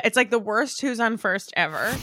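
ordinary talk, nothing marked

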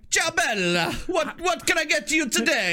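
hammed-up Italian waiter greeting; mock Italian waiter. Italian waiter greets a customer in an Italian accent.

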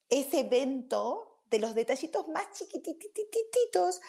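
a fussy guardian rushing to reassure; reassuring amygdala. Retold or imitated. Teacher voicing the amygdala to her audience, high mock-soothing. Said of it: "sino que está diciendo".

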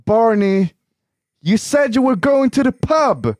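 a high, loud, drawn-out scolding of Barney; mock-scold Barney like a sitcom girlfriend; playing a sitcom character calling out Barney; How I Met Your Mother scene. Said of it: "jag kollar på How I Met Your Mother".

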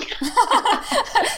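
bursting high laugh. Real, not acted. Laughing at herself for running away, a high laugh. Laughing at her own avoidance.

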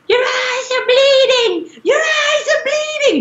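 high, terrified scream; mother screaming in a dream, terrified; mom screaming in terror. Retold or imitated. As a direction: shriek it like a frightened ghost mother. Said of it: "she was, like, screaming".

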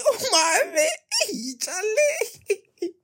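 words squeezed out high through laughter; high giggling words; laughing. Real, not acted. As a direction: laugh while saying it. Laughing at chat.

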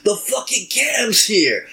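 high, stretched-out cry of alarm; enemy's warning cry. Acted. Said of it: "the only thing he manages to get out is".

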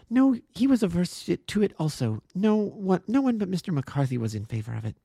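put-on high, urgent young woman defending her friend; earnest young woman. A narrator's reading. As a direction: plead with fierce conviction. Miss Turner answers Holmes.

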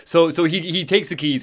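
normal talking voice

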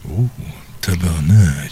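low, drawn-out oath; imitating swear soundbite. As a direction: drag it out low like the clip.

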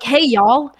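a quick mock Southern hey y'all; Southern accent. Friend teasing a Tennessee gamer's Southern accent. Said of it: "Is he like".

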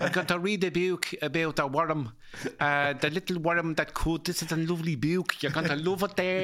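tough guy sweetly selling a picture book; father reading to his son as a tough-guy gangster; De Niro bedtime story. Pretend. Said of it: "read all the bedtime stories in that De Niro".

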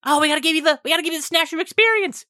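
put-on high, eager child voice; excited kid. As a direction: pipe up in an eager rush. Excited child offers a treat.

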